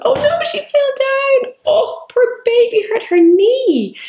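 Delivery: very high, slow, sing-song pity for a doll; mock dismay as the toy baby falls; acted sympathy. Shown as a demonstration. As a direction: coo over the baby's hurt knee. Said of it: "You can really act these out".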